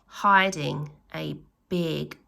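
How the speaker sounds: plain reading voice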